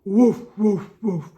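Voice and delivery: low barking woof; big dog woof. Acted. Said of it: "Are we a big dog?".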